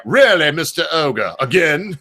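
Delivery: exasperated Brit, hammed-up English accent. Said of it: "my attempt at an English accent".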